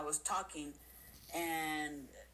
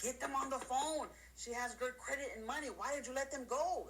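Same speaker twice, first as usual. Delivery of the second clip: higher-pitched mock boss demanding; angry boss; 7 semitones higher than usual. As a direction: bark it like an annoyed sales boss. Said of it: "their boss is gonna be like".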